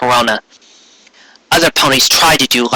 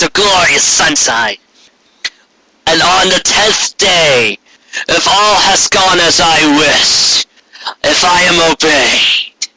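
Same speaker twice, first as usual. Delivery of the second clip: higher, slow, grand tyrant declaring; Corona's decree; 4 semitones higher, slower than usual. A narrator's reading. Tyrant queen decreeing to ponies, higher slow grandeur. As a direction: announce it like a triumphant queen. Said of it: "Corona called".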